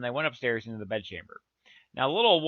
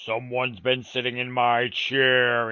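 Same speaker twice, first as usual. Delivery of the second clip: slow, loud gruff big bear; great huge gruff voice; 5 dB louder, slower than usual. A narrator's reading. Big bear finds his chair sat in, gruff and slow. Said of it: "in his great, huge, gruff voice".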